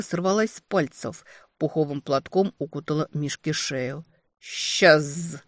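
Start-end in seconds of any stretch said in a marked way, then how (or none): 4.4-5.4 snarled with a bared grin; bare the teeth and snarl it; inhuman snarl. Acted. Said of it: "совсем не по-человечески оскалился".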